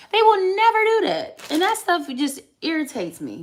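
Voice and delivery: high, loud indignant cry; high-pitched exasperated exclaim; indignant outburst. Real, not acted. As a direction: throw it out, fed up and sure. Ranting about absent fathers.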